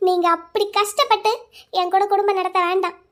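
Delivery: usual speaking voice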